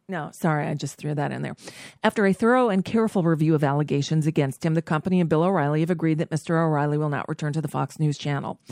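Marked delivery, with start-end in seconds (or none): 2.0-8.6 rushed, rapid reading; fast read. Shown as a demonstration. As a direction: rattle it off fast. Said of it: "second, rushed reading".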